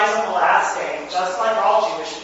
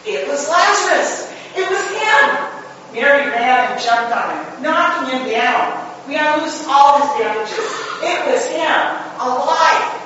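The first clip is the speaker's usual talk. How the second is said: joyful disbelief; 6 semitones higher, 5 dB louder than usual. A narrator's reading. Lazarus unwrapped, alive.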